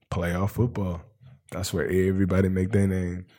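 ordinary talk

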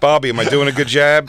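loud mock Boston voice; hammed-up Boston accent. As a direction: play the Boston girl asking for approval.

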